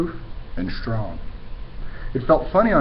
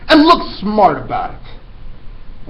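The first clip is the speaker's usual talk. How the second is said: loud high command; shouted order; 8 semitones higher, 6 dB louder, much slower than usual. Acted. Headmistress shouting at the boy to come up. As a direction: shout him up to the platform.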